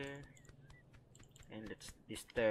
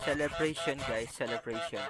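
excited cheer; 8 dB louder than usual. Real, not acted. Cheering a finished game task.

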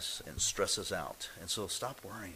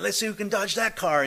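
high, loud playful taunt; mock driver taunt; 10 semitones higher, 7 dB louder than usual. Driver taunting the birds, high loud mock call. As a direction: tease like a reckless driver.

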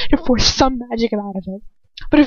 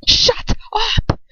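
mock shout; 13 semitones higher than usual. Attacker shouts at mocking captive, strained and high. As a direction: snap it out high. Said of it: "he shouts".

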